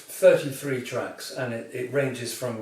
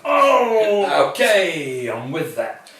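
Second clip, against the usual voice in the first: mock pained surprise; 5 semitones higher, 10 dB louder, much slower than usual. Mock reaction to cast removal.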